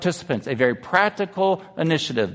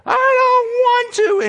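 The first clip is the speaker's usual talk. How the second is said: very high, loud mock kid wail; imitated little girl's outburst, pitched way up; child's tantrum; 6 dB louder than usual. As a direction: throw a fit like a four-year-old. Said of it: "she threw the biggest fit".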